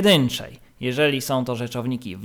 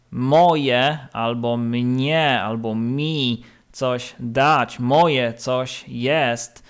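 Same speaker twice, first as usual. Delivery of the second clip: slow, deliberate; much slower than usual. Shown as a demonstration. Teacher explaining to pupils, very slow. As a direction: drag each word out. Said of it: "Macie jeszcze problem".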